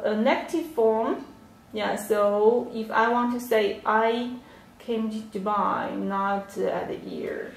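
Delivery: ordinary talk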